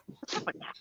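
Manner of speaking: hushed, breathy whisper. Retold or imitated. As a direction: whisper it so nobody hears. Secret question to coconut seller.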